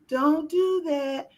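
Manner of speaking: don't drawn out high and slow, gently scolding; mock nice mama. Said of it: "They got the nice mama".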